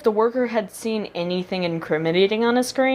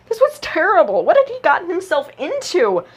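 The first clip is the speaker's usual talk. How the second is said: higher, tense, panicky narration; rising panic; 9 semitones higher, 3 dB louder than usual. A narrator's reading. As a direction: read it with mounting alarm. Izuku panics.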